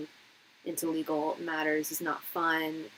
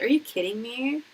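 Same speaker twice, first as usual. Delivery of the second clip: incredulous; 5 semitones higher, 3 dB louder than usual. Retold or imitated. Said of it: "I was like".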